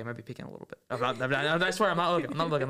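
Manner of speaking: normal talking voice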